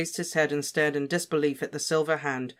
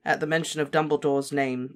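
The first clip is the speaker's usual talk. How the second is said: ordinary delivery, nothing marked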